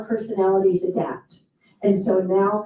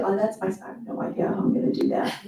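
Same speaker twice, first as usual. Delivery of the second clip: softer, fast, under-the-breath mock panic; panicked aside; 3 semitones lower, 5 dB quieter, faster than usual. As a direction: rush it as a secret confession. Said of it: "You want me to do".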